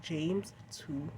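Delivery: usual speaking voice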